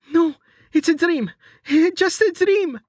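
high, frightened denial; terrified pleading. A narrator's reading. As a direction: plead it away in terror. Waking from nightmare in terror.

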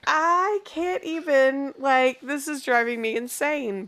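high, slow, drawn-out exasperation; mock exasperation. Mom retelling her frustration at the dishwasher, mock exasperation. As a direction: drag it out like you've had enough. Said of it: "I was like".

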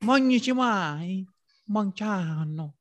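fake foreign language. Dodging a street fundraiser, high slow fake-language babble. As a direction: drawl out nonsense words like a foreign tourist. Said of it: "some fake language".

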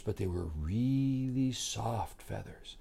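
very soft, near-whispered telling; hushed emphasis. A narrator's reading. Storyteller describes feathers under the wings, hushed.

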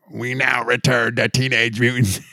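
mock TV announcer, cut off mid-title; announcer bumper voice. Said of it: "that weird bumper they would do".

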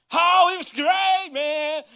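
high, loud, drawn-out gush; Oh it was great, stretched with delight; gushing excitement. Retold or imitated. A pastor mimicking a happy storyteller, gushing.

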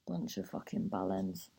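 whispered, disgusted curse; muttered swearing. Real, not acted. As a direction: mutter it under your breath. Muttered insult after rant.

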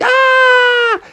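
long, very high cartoon scream; hammed-up terrified shriek; scream of fright. Froggy found by the action figure, frightened scream.

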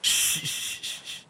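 repeated hissed hushes; urgent shushing. Acted. Boy hushing the grieving sea man, urgent shushing. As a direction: shush them without voicing.

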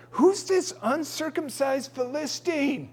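put-on young David scoffing at the giant; indignant young David. David voiced in Bible story.

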